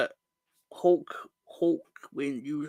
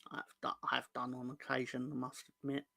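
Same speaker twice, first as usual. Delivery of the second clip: much softer, confessional aside; quiet admission; 4 semitones lower, 15 dB quieter, faster than usual. Real, not acted. Streamer confessing to chat he watches women's football, much softer. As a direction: drop it down as if admitting a secret.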